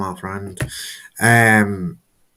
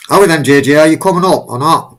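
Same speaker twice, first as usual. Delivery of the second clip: host calls a guest up to join, calling out loudly; 6 semitones higher, 11 dB louder than usual. Real, not acted. Host hurrying JJ onto the stream, calling out. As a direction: call out loud and higher to someone not yet here.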